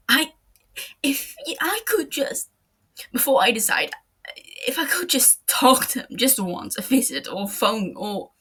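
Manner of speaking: loud, broken, halting plea; pleading in grief. Acted. Matsuda begging L to let him see Light, broken and pleading. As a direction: stumble over the words, desperate.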